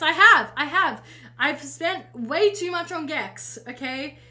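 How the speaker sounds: loud, insistent admission; defensive loud reply. Real, not acted. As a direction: insist it back at the chat. Defending herself to chat.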